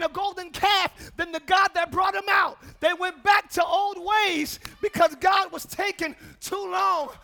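loud, rousing sermon voice; fiery preaching. Real, not acted. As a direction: preach it out to the congregation. Preaching at full force.

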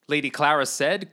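ordinary delivery, nothing marked

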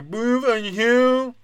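high, slow, slurred mocking voice; mock deaf guy voice. Comic mocks quarterback calling a play, slurred high voice. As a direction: drag out the calls like a dazed quarterback. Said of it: "sounds like a deaf guy".

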